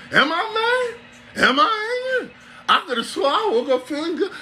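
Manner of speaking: very high, squeaky self-doubt; hammed-up meek confusion in a tiny high voice; bewildered slave.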